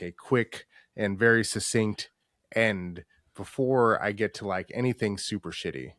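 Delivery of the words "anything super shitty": ordinary delivery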